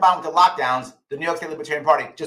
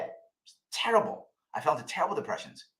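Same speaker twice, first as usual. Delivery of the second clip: whispered; 10 dB quieter, faster than usual. Real, not acted. Recalling depression.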